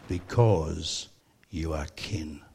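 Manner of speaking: low and soft, retelling a solemn declaration; soft, low imitation of a meaningful pronouncement; hushed, weighty reveal. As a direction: let the reason land quietly. Retold shopkeeper solemnly reveals the reason.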